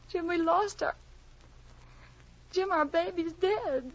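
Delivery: high, halting, drawn-out sorrow; grief-stricken. Acted. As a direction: break the news through heartbreak. Mother tells husband baby died.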